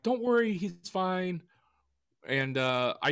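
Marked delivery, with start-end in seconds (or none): 0.0-1.4 reassuring. Retold or imitated. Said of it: "And I'm like".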